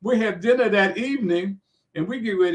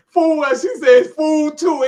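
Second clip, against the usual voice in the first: loud, higher mock scolding; scornful disbelief; 8 semitones higher, 9 dB louder, slower than usual. Sleepy wife mocking news that Ali called, loudly scornful. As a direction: act out a wife loudly scoffing.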